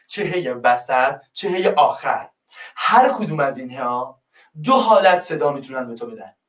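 ordinary delivery

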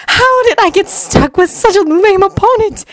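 high, exasperated gripe; aggrieved, high-pitched complaint; complaining. Acted. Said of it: "Temari complained".

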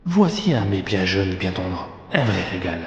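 low, hushed wolf musing to himself; scheming wolf. A narrator's reading. Wolf plotting to catch them both, low and sly. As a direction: plot softly and low, relishing the meal.

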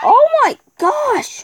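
high, drawn-out gasp of shock; surprised. Real, not acted. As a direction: stretch it out, genuinely startled. Sudden shock in the cave.

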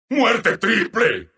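measured announcer call, falling at the end; restrained announcer call. Acted. Announcer reporting a triple kill, restrained.

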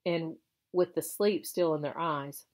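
usual speaking voice

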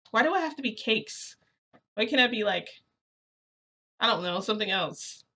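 mildly exasperated pleading question; indignant protest. Retold or imitated. Retold protest at the nickname.

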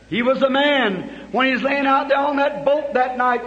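normal talking voice

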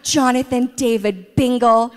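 put-on slow, loud scolding call of the child's full name; mock-furious mom calling her son's full name; angry full-name call. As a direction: bellow his whole name like a fed-up mom. Mom furious at defiant son.

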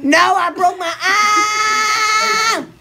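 loud wail of complaint; loud, overdone cry; yelling. Real, not acted. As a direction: holler it, dragging out the last word. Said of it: "No more yelling".

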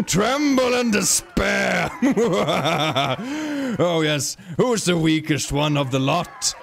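slow, drawn-out menace with evil laugh; gloating monster villain. Pretend. Creature threatening its weaker prey, gloating villain. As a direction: relish the threat like a hammy villain.

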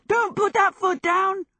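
high, loud urgent yell. A narrator's reading. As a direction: yell out a frantic warning. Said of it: "Michael yelled".

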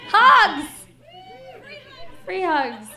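sudden loud high cry of Hugs; excited shout. Real, not acted. Comic yelling an offer of hugs to the crowd. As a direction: burst out offering free hugs. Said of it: "offers hugs to the crowd".

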